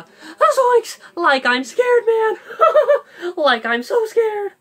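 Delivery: very high laughing frightened Shaggy; scared Shaggy. Shown as a demonstration. Host showing scared Shaggy to viewers, high laughing panic. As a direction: squeal it out, half laughing. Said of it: "let's say".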